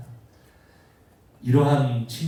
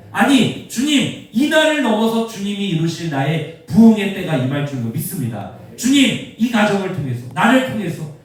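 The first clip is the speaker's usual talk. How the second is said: loud, high cries to the Lord; acted prayer at full preaching force; fervent prayer; 6 semitones higher, 8 dB louder than usual. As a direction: declare faith in an impassioned shout. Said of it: "이러면서 이 사람은 기도하기를 쉬지 않는".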